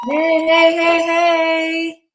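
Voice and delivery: high, loud sing-song heys; excited greeting. Real, not acted. Guest joining the stream greets everyone, high and loud. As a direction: burst in with joy.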